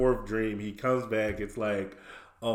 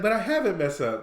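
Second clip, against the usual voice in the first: imitated protest; 9 semitones higher, faster than usual. SpongeBob protesting to Squidward.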